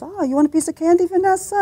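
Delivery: mock doting grandfather; sweet grandpa. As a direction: coo at the little girl like a doting grandfather. Grandfather offering candy.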